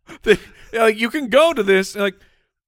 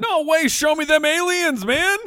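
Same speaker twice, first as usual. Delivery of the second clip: excited; 12 semitones higher than usual. Real, not acted. Excited about meeting aliens.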